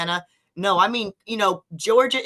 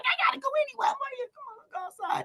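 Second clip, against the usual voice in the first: high soft friend voice; 10 dB quieter, much faster than usual. Retold or imitated. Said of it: "She's like".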